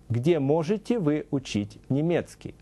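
ordinary talk, nothing marked

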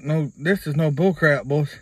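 normal talking voice

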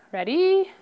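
Ready called up high; rally the class to answer; bright cue. Real, not acted. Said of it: "cueing the class".

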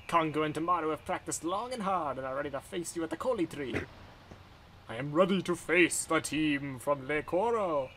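ceremonial game-character voice, lightly put on; welcoming, then solemnly announcing the match; game character greeting travelers. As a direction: read it out as a formal village official.

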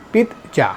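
পিৎজা as "PIT-ja", high first beat; correct pronunciation. Shown as a demonstration. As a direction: stop on the t inside পিৎজা. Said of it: "এটা সঠিক উচ্চারণ হবে".

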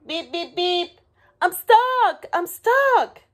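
very high little-truck squeal; crying in alarm. A narrator's reading. Little truck calling animals to help, high alarmed cries. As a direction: build from beeps to loud cries. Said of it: "cried the little blue truck".

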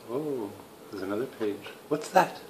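ordinary talk, nothing marked

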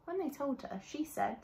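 ordinary delivery, nothing marked

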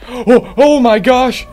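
high loud thrilled cry; excited player finding the way; excited gasp. Real, not acted. Finds the lanterns.

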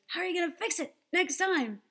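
lightly voiced boss pressing the worker; mock stern boss. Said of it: "your boss says".

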